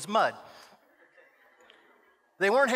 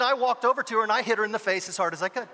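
ordinary delivery

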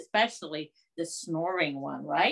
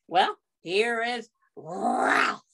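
ordinary delivery

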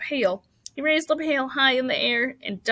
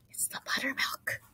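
hushed, unvoiced whisper; 3 semitones higher, 10 dB quieter than usual. A narrator's reading. Missus Arabelle to Missus Zuckerman about buttermilk, whispered. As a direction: whisper it confidentially. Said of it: "whispered Missus Arabelle to Missus Zuckerman".

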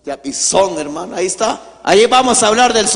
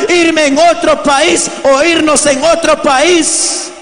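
raised, higher preaching voice; impassioned preaching; 8 semitones higher than usual. Acted. Preacher tells the congregation of migrant sons, impassioned preaching.

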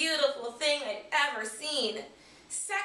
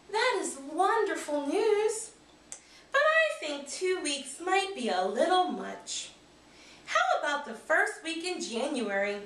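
a hint of a warm teacher, higher; kind teacher, lightly voiced; 5 semitones higher than usual. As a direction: gently offer a treat to the boy. Teacher cheers up Garth.

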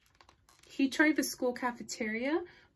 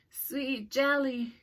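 slow, dreamy zombie moan; 2 semitones higher, much slower than usual. Acted. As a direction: moan it longingly, very slowly. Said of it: "moaned Reginald".

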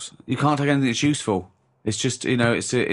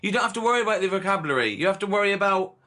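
high-pitched incredulous protest; exasperated; 6 semitones higher than usual. Real, not acted. Host disputes friend's need for a dictionary, exasperated. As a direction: argue back in exasperated disbelief, voice pitched up.

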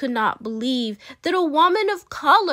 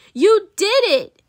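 high, loud cheer of engineers; 9 semitones higher, 7 dB louder than usual. Acted. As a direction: cheer her success.

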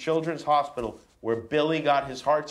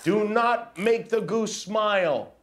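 higher, slower hammed-up dismay; mock alarm; 8 semitones higher than usual. Dad reading to his kids, hammed-up panic over the smiling goose.

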